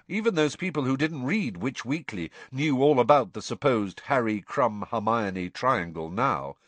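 plain reading voice

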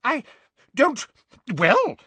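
high, stuttering bluster. A narrator's reading. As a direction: splutter without finishing. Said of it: "blustered Fudge".

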